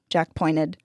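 usual speaking voice

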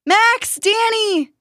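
high, stretched name call. A narrator's reading. Jack calling toward the rumbling soil, urgent call. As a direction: call out high to buried friends. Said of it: "Jack called as he got closer".

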